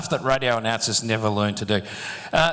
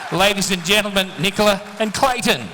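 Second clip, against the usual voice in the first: raised, high-pitched host announcement; big stage announcement, pitched up; rousing announcer call; 7 semitones higher, 4 dB louder than usual. Real, not acted. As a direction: call it out over the applause. Host announcing couple to applauding audience.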